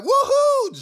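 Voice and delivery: high, loud mock celebration whoop; imitated gleeful woohoo; excited whoop. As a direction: whoop like he's jumping for joy. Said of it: "jumping in fucking excitement".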